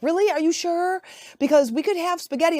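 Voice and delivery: mock-fretful wife asking twice; imitating wife. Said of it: "Amy is just like".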